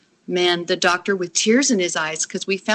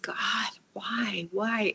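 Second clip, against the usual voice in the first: whispered, broken-off plea to God; very soft, helpless questioning; retold anguished plea; 2 semitones higher, 13 dB quieter, much slower than usual. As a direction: act out a bereft prayer, barely voiced.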